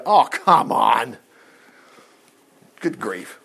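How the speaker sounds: slow, drawn-out "come on"; exasperated. Real, not acted. Preacher dismissing a misreading of loved him, exasperated. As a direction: stretch the words out in exasperation. Rejecting a reading of the verse.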